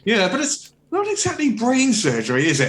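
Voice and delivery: high-pitched mock sympathy turning smug; condescending put-down. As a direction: patronize them with exaggerated rising pitch. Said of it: "he's just putting everybody down".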